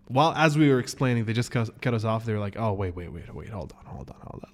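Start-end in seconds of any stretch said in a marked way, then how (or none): 2.6-4.5 low, soft string of interruptions; interrupting clerk. Retold or imitated. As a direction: imitate staff stalling, sinking to a murmur. Imitates staff cutting them off.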